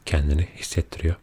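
ordinary delivery

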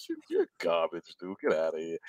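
low, dismissive trash talk; condescending. Retold or imitated. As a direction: sneer it down at him, low and dismissive. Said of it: "I love how condescending it is".

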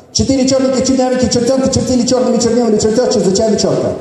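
fast, even tongue-twister patter; rapid-fire recital. Shown as a demonstration. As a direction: rattle it off quickly in one go. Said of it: "Next tongue twister about four boys".

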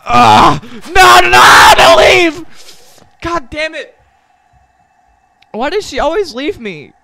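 high, loud anguished cry; mock despair wail. Streamer howling as Hornet runs off, mock heartbroken. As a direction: wail as she leaves you. Boss leaves, he wails.

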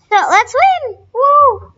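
high, loud whoop; excited cheer. Real, not acted. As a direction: let it burst out with joy. Cheering before jumping in.

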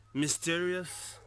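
ordinary talk

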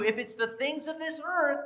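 ordinary talk

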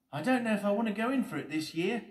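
high-pitched, loud character voice; Steady Eddie, unsure. Acted. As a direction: voice an uncertain Eddie, high and quick.

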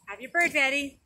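ordinary talk, nothing marked

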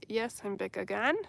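ordinary delivery

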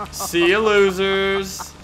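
high, loud, slow taunt. Acted. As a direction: drag it out to mock them. Taunting while driving away.

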